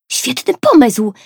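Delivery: high, loud exclaim. A narrator's reading. Kajtek exclaims at Tosia's idea, excited. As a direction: burst out with delight. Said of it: "wykrzyknął Kajtek".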